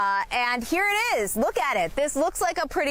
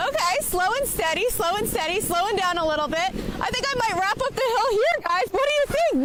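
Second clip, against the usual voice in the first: sledding downhill; 6 semitones higher than usual. Real, not acted. Sled slowing at bottom.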